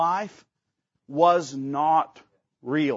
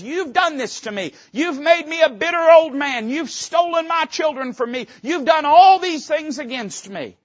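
bitter accusation; 8 semitones higher, 4 dB louder than usual. Acted. Preacher voices Jacob accusing sons.

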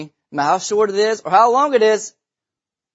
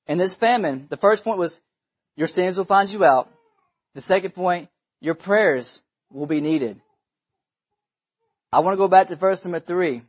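ordinary talk, nothing marked

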